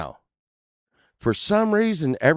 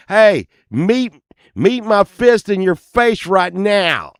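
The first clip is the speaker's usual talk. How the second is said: mock threat; 6 semitones higher, 6 dB louder, slower than usual. Speaker's imagined retort.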